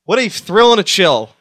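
loud, high, hammed-up amazement; mock thrill. Host marvelling at his own scare, hammed-up and loud. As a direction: draw out the fun surprise with showy excitement. Hammed reaction after screaming.

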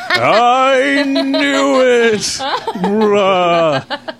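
high, loud, long-drawn ghostly wail; Ghost of Christmas Past. Acted. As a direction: howl it slowly like a hammed-up phantom. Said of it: "like the ghost of Christmas past".

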